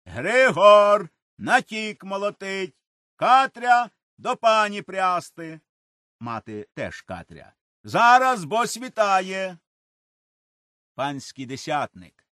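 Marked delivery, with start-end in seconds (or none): 0.0-5.6 loud calling shout through the door. A narrator's reading. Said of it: "кричить". 7.8-9.6 loud higher call from inside; calling back. A narrator's reading. Father answers overseer.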